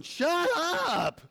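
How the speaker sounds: God snapping in comic impatience; mock God shout. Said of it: "I can just see God going".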